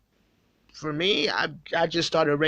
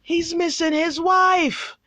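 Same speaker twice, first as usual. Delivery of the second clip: mock pining king; 10 semitones higher, slower than usual. Mock whiny king missing wife.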